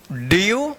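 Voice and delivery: ड्यू drawn out as "dyoo", rising; model reading of dew. Shown as a demonstration. Teacher gives another example for learners, ड्यू lifted at the end. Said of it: "यू उच्चारण होगा".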